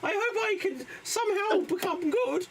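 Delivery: squeaky high comic voice; high put-on voice. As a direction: pipe it up high and nervous. Improv player nervous, high voice.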